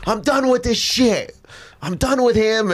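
fed-up friend. Retold or imitated. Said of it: "He goes".

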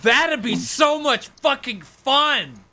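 high, slow gleeful shout. Real, not acted. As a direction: crow it with delight. Gleeful about nerfing game.

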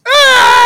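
very high, loud mock scream; melting Nazi scream. Host imitating the Nazis melting in Raiders, high loud scream. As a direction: shriek like a melting face. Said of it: "melting scene with the Nazis where they just like".

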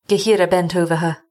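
plain reading voice

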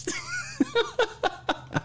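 high wheezing laugh; real high-pitched cackle. Real, not acted. Laughing at bless his heart.

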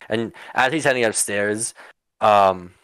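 ordinary delivery

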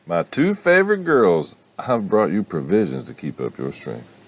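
high, loud Southern greeting, settling down; Southern accent. Acted. Mother bringing food to her two favorite girls, Southern accent. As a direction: sweet-talk them in a Southern accent. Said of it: "why she has a southern accent".

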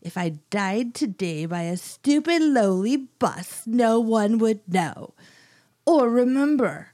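drawn-out, indignant mock-British accent; soap opera accent. Old lady killer scorning a lowly bus, soap opera accent. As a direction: stretch the key words in a weird British-Italian accent. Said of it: "like a soap opera accent".

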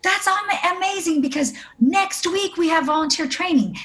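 high-pitched imitation of an excited friend; delighted surprise. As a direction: pitch it way up, thrilled at the news. Retells friend's delighted reaction.

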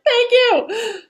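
very high sing-song thank you; thanking the pageant coach, giddy; gushing thanks. Retold or imitated. Her gushing reply.

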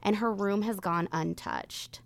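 grave true crime narration; suspenseful crime show narrator. Acted. Reads the case introduction.

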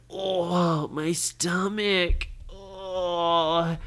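drawn-out moan of a bellyache; sick groaning. Acted. Brady suddenly feels Caleb's sickness, groaning. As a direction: groan slowly through a sore stomach. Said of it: "He felt so sick".

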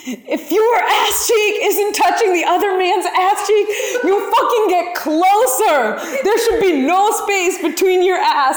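captain barking at dancers, half laughing; captain's yell. Retold or imitated. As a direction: boss the dancers into place. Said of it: "one of the other captains was like".

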